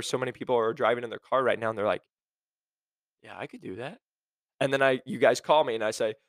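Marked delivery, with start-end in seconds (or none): 3.2-4.0 much softer, casual aside; offhand self-assurance. Acted. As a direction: toss it off quietly, as if to yourself. Imagined listener casually claiming confidence.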